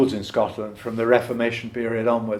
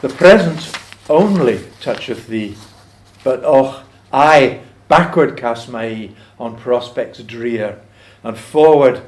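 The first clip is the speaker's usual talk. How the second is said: slow, weighty, emphatic verse; poem recitation; 5 dB louder, slower than usual. Acted. Farmer addresses the mouse at the poem's close, solemn recitation. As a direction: declaim it, then let the last line fall away.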